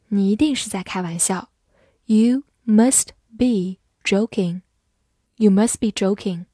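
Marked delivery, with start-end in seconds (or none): 2.0-4.6 slow, each word set apart; slow reading. Shown as a demonstration. Teacher models the sentence for learners, slow reading.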